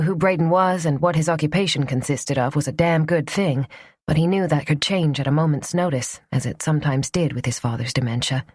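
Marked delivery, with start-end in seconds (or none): none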